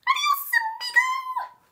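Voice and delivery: very high loud squeaky taunt; gleeful farewell; cheeky mouse. Acted. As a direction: mouse mocking Daddy.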